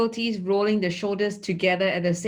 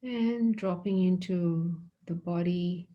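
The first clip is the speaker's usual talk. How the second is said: soft, unhurried meditation voice with long pauses; guided meditation; 4 dB quieter than usual. Acted. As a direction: guide them quietly into the body scan. Leads a body scan meditation.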